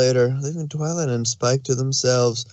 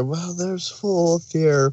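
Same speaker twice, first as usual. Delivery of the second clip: ordinary talk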